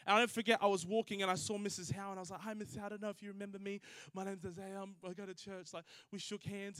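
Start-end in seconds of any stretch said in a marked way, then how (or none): 2.4-5.7 soft, hurried, tentative greeting; timid. Retold or imitated. Nervous churchgoer greeting the woman with the knife, timid. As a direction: introduce yourself quietly and warily, trailing softer. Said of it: "I was sort of scared".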